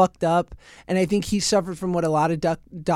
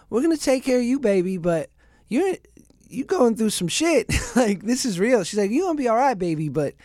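put-on Southern nurse accent; 5 semitones higher than usual. Nurse reassuring the patient in the ER, mock Southern accent. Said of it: "this great Southern accent".

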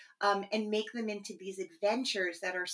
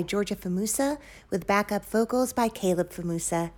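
credits announcer read; 9 dB louder than usual. Acted. Credits read over music.

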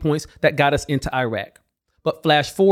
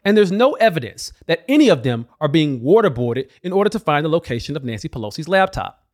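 ordinary delivery

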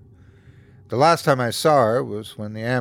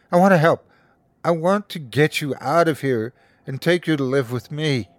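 sobbing plea; 5 semitones higher than usual. A narrator's reading. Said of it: "sobbed loudly".

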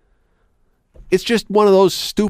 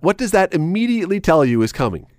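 ordinary delivery

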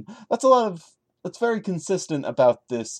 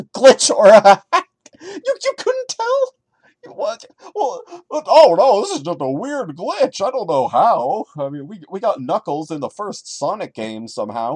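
mocking narrator; 6 semitones higher, 7 dB louder than usual. Mocks the story's narrator.

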